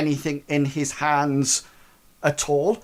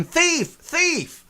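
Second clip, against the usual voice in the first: mock thief call. Shown as a demonstration. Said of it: "you should start shouting".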